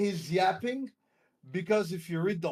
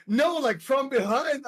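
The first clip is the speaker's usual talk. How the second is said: higher, louder exasperated retort; exasperated retold correction; 7 semitones higher, 5 dB louder than usual. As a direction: correct him, half-laughing in disbelief. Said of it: "she stops and is like".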